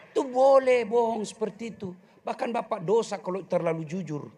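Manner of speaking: ordinary talk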